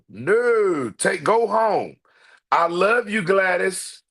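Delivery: ordinary delivery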